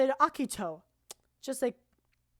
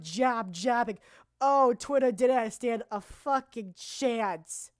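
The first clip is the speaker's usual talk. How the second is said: normal talking voice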